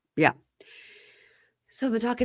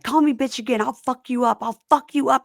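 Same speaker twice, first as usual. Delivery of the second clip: retold yelling; 7 semitones higher than usual. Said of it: "she's yelling".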